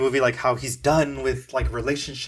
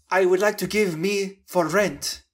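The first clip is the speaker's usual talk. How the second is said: imitating the actress's shaky Russian accent, pitched up; lightly parodied Russian accent; mock Russian accent; 6 semitones higher than usual. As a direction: put on a wobbly fake Russian accent for the line. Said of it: "that's the way she's talking".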